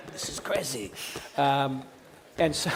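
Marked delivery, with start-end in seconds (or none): 0.1-0.9 put-on very soft French-accented grumble, half laughing; French angry developer. Host lampooning French developer, faint French accent. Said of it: "my French angry developer guy".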